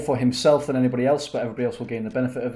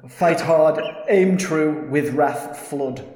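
declaiming poem; 3 semitones higher, 6 dB louder, much slower than usual. Acted. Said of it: "I start again".

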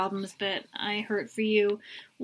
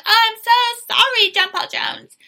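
squealing mock apology; 12 semitones higher, 8 dB louder than usual. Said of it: "She's like".

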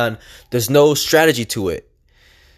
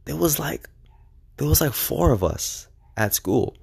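whispered aside; 6 dB quieter than usual. Real, not acted. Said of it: "whispered aside".

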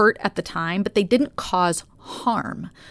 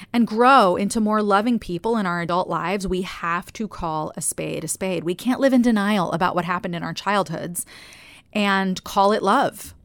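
ordinary delivery

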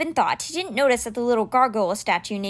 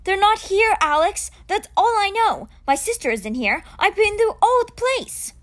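raised voice, higher and louder; 9 semitones higher, 4 dB louder than usual. A narrator's reading. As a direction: push it out, frustrated and loud. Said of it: "Scene raised his voice".

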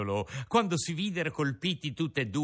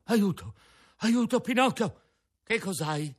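panicked cry for help; 7 semitones higher than usual. Acted. Lucignolo collapses, cries for help.

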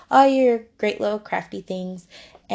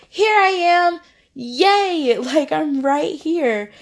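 high, loud, laughing cheer; excited wisdom; 6 semitones higher, 4 dB louder, slower than usual. Pretend. Wisdom cheers at the seeker, high and laughing. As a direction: cheer that you are right here. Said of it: "Wisdom is like".

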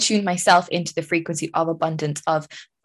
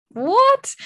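shocked what; 3 dB louder than usual. Retold or imitated. Unexpected money arrives.

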